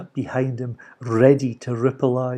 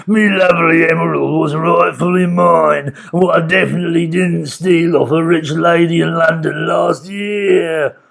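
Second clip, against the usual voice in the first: villain voice; 4 semitones higher, 5 dB louder than usual. A narrator's reading. Mister Gum laments.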